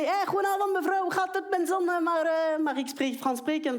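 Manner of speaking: put-on high, eager broken Dutch with a French accent; mock Flemish. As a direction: overkeen parent butchering Flemish. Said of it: "j'arrive hyper motivée".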